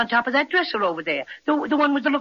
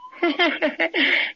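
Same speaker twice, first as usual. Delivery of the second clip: pleased chuckle; 5 semitones higher than usual. Acted. Bear laughs at fleeing spider.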